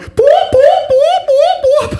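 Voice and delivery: very high, slow, loud electronic boops; machine beeping. Retold or imitated. Comic imitating the airport scanner alarm, high beeps. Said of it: "the machine starts going".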